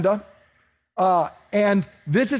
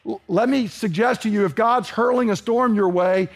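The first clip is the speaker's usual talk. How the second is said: ordinary talk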